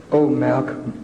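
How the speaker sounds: very low, measured teacher with long pauses; discouraging teacher. Retold or imitated. Preacher quoting the teacher who discourages Malcolm, low measured teacher voice. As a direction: drop right down and let the pupil down in measured phrases. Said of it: "The teacher said".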